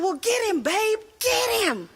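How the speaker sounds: mock-rallying cry, stretched at the end; egging him on. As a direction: cheer him into action. Wife eggs husband on.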